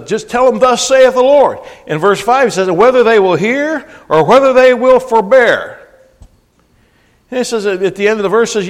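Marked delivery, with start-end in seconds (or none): 0.1-1.6 loud, high ringing proclamation; proclaiming. Acted. Preacher urging pastors to cry out boldly. 2.7-5.8 proclaiming. Acted. Preacher proclaiming scripture.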